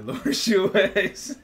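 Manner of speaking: high, loud, drawn-out laughing intro; laughing name drop. Real, not acted. Host introducing himself to viewers, laughing loudly. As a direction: burst into laughter announcing the name.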